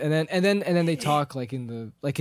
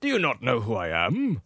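lofty Gandalf; 4 semitones lower than usual. Retold or imitated. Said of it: "he goes".

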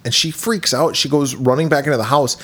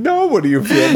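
mock spooky host; 7 semitones higher than usual. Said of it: "host voice".

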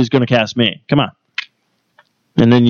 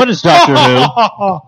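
mock innocence; 8 semitones higher, slower than usual. Pretending ignorance to provoke.

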